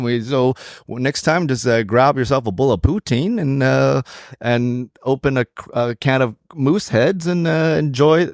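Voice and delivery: lilting, hammed-up Canadian; Canadian accent. Co-host thanking listeners at the end of the show, hammed-up Canadian accent. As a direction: play the folksy stock Canadian, badly faked. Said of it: "in your best Canadian accent".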